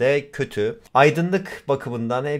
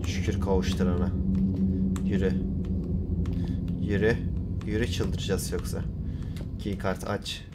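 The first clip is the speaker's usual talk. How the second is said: low, quiet, worn-out murmur; subdued muttering; 7 semitones lower, 7 dB quieter than usual. Real, not acted. Gamer sneaking on after losing his patience, subdued. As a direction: mutter under your breath at low pitch.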